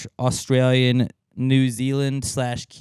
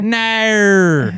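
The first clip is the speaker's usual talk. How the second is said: "No" stretched much higher and louder in a mock Aussie accent; mock Australian "No"; 11 semitones higher, 8 dB louder than usual. Answering a friend's question in a loud, high, hammed-up Aussie accent. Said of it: "That's how I would say it in Australian".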